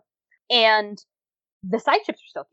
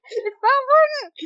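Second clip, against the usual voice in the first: gushing; 3 dB louder than usual. Real, not acted. Gushing about a beloved scene.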